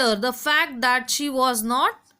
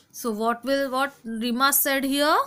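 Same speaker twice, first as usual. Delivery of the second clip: ordinary talk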